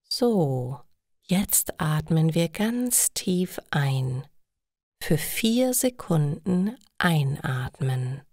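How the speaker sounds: slow, hushed breathing instruction; guided meditation. A narrator's reading. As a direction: lead the room through breathing calmly. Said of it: "Plötzlich sagt die Frau".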